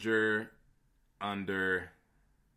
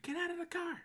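high squeaky plea; 8 dB quieter, much faster than usual. Pretend. Mock pleading about raids.

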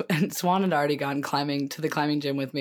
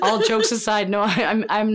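laughing; 6 semitones higher, 6 dB louder than usual. Real, not acted. Laughing through the words.

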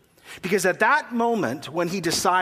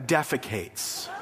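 slow, prim, mock-refined delivery; mock polite word; 5 semitones lower, 4 dB quieter, slower than usual. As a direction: say it slowly as the proper word for the refined ones. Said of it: "for you who are better".

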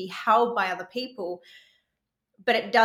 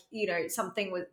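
ordinary talk

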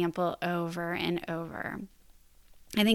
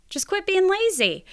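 much higher, playful scolding; pitched way up, punchy and exclaiming; emphatic high repeat; 12 semitones higher, 4 dB louder than usual. Shown as a demonstration. Repeats blunt advice in mock exasperation.

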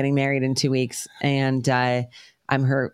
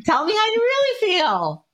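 much higher, sing-song imitation of mom; mom's voice. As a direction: mimic mom teasing her daughter. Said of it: "My mom's like".

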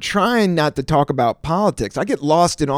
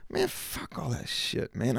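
whispered dismissal; 11 dB quieter than usual. Real, not acted. Dismissive aside under breath.

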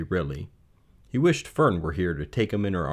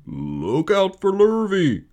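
long, loud, high moo; drawn-out cow warning; cows calling; 12 semitones higher, 5 dB louder, slower than usual. Acted. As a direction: moo a slow warning call. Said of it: "called the cows".

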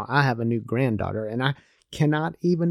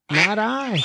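noisy duck; 9 semitones higher than usual. Acted. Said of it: "said the noisy duck".

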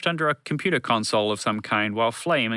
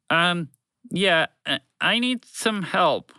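higher-pitched, hesitant plea; pleading; 7 semitones higher, 3 dB louder, much slower than usual. A narrator's reading. Broadcast voice pleading with the group for help, halting and high. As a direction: ask awkwardly for help, drawing it out. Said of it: "the voice pleaded".

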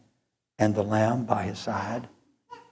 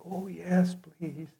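higher, fading to a breathless whisper; a dying man barely getting the words out; lightly voiced old man, trailing into a whisper; frail old man; 6 semitones higher, 5 dB quieter, slower than usual. Dying old preacher answers softly.

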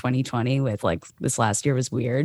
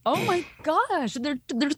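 can't believe what she's hearing; shocked disbelief; 9 semitones higher than usual. Retold or imitated. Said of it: "I was like".

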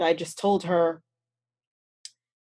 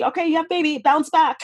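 put-on much higher, breezy sarcastic "you have a baby, bounce back"; sarcastic mock chirp; 9 semitones higher, 3 dB louder, faster than usual. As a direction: parody the cheery push to recover fast. Mocking expectations after having a baby.

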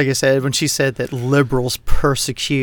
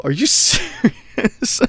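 slow, high disbelief with a laugh; laughing, stunned "are you serious"; incredulous; 8 semitones higher, much slower than usual. Retold or imitated. Retold outraged reaction.

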